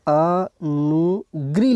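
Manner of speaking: अनुग्री stretched out very slowly; अनुग्री in long, separated syllables. Shown as a demonstration. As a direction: draw out each syllable of अनुग्री, as if writing it. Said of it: "ऐसे लिखते हैं".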